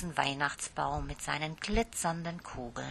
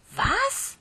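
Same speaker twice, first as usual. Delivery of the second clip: sehr hoch und laut aufschreckend; entsetzt; 6 dB louder than usual. Acted. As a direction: fassungslos hochfahren. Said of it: "fragte Lieschen entsetzt". (Lieschen hört, dass das Krippenspiel ausfällt)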